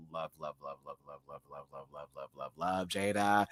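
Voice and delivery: very soft, low rapid repetition; hushed adoration. Real, not acted. Host adoring Jada, hushed low chant. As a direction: murmur it on one low note. Gushing over Jada, near whisper.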